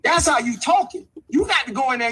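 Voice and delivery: ordinary talk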